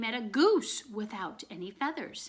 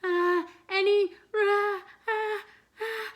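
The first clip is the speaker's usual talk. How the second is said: high, slow, halting build to a sneeze; the words catch as a sneeze comes; about to sneeze; 11 semitones higher, 5 dB louder, slower than usual. A narrator's reading. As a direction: rag man's words breaking off before a sneeze. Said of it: "the rag man started to sneeze".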